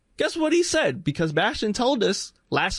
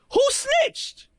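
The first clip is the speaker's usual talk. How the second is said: squeaky high mock demand; shrill parody of an indignant politician; mock high who snitched; mock outrage. Mocking Republicans, repeated question.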